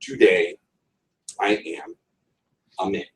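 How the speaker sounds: "Today I am a man" split into stressed pairs, with pauses; slow iambic beats. Shown as a demonstration. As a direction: beat out each foot slowly. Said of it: "The first is a pickup, the second one is stressed".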